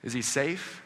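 softly asked with a rising end; light, hushed query; questioning Susan. Acted. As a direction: ask it as a sincere, uncertain question. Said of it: "Susan's asking Mister Beaver about Aslan".

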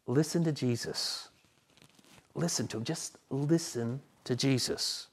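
quiet earnest call to listen; soft plea. Acted. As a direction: draw them in close and gentle. Pleading application, dropped soft.

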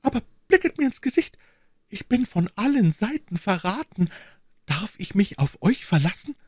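high-pitched, urgent queen; anxious queen. Acted. Betrayed queen asks whether she can trust her servant, anxious. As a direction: plead for proof of loyalty in a high voice.

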